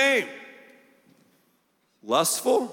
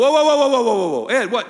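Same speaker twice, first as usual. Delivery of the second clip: loud, higher burst of whoas that drops back down; mock alarm; 5 semitones higher, 8 dB louder, much faster than usual. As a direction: imitate the startled congregation, loud and high. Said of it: "some of you are like".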